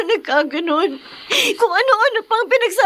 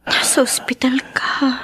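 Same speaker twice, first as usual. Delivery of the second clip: whisper; 5 semitones lower than usual. Acted. Quiet to waking father.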